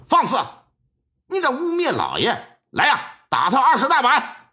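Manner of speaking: a constable roaring at an insolent man; angry bark. Acted. 衙役喝斥冯志栋. (厉声呵斥)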